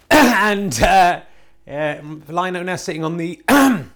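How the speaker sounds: clearing a scratchy throat. Real, not acted. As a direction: almonds caught in the throat. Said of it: "they're caught in my throat".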